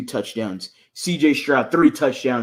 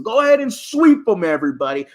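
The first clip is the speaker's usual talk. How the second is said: hype shout; 6 semitones higher, 5 dB louder than usual. Real, not acted. Hyping the team to win.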